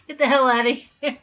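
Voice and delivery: loud laughing send-off; laughing dismissal. Real, not acted. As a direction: shout it out while laughing. Joking dismissal through laughter.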